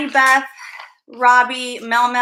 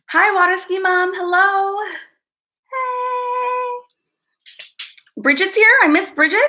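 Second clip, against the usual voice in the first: excited high greeting; 8 semitones higher, slower than usual. Real, not acted.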